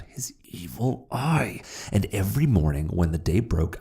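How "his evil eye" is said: slow, menacing whisper; whispered menace. A narrator's reading. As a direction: hiss out the evil eye. Hatred of the eye.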